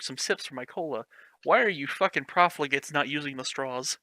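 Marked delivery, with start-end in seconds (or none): none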